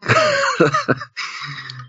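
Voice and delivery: squealing burst of laughter; high-pitched laugh. Real, not acted.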